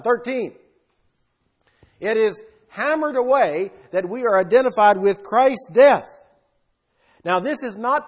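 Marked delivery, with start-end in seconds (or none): 2.0-6.1 rising to a loud punch on death; hammering it home. Real, not acted. Preacher pounding his point to the congregation, loud on death. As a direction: drive the word death home hard.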